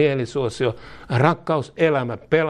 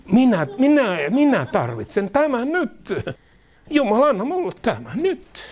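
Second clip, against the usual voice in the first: mock selfish demand; 9 semitones higher than usual. Preacher mocking the self-centred believer, whiny and demanding. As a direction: play the grabby ego demanding.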